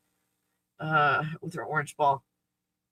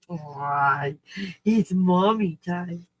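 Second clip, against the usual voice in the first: doting coo; much slower than usual. Acted. Cooing to dog.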